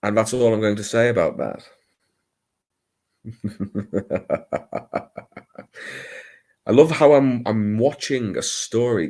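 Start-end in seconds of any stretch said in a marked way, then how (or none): 3.3-5.2 long staccato chuckle; soft rolling run of ha-ha-ha; laughing. Real, not acted. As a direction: laugh it out in a falling string. Laughs alone after a remark.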